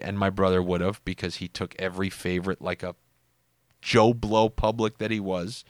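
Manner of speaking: usual speaking voice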